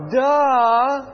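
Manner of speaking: put-on loud bratty kid's duh. Shown as a demonstration. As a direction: warble it like a mocking kid. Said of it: "They kinda have a warble to their voice".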